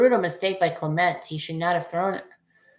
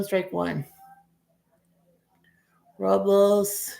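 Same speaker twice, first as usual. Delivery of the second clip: usual speaking voice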